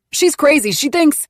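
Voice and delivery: high, loud accusing shout; yelling. Acted. Angry man shouting at a crowd, loud. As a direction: shout her down as crazy.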